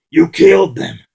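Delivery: furious shout of grief; yelling. Acted. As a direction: hurl the accusation at him. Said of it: "He yelled".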